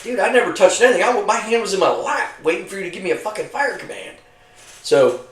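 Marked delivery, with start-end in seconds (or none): 0.0-4.2 high-pitched, indignant retold protest; protesting innocence. Soldier denying blame to his yelling crewmate, high-pitched protest. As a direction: insist you never touched it, voice pitched up. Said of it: "Wagner's yelling at me. I'm like".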